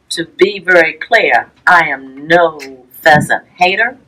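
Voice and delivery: ordinary delivery, nothing marked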